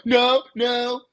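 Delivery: high, loud mock no from the US; hammed-up whiny no; mocking refusal. Mocking the US to a friend, high loud mock refusal. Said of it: "you're gonna be like".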